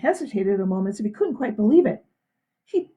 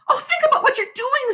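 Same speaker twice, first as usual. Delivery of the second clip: pleading in a raised voice; high pleading woman; 3 dB louder than usual. A narrator's reading. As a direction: beg them to stop.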